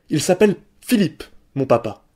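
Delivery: flung out high and loud like a stone; defiant shout. A narrator's reading. Boy throwing his answer at the schoolboys, high defiant shout. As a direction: hurl the name at the bully. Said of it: "Simon lui jeta ces mots à la tête".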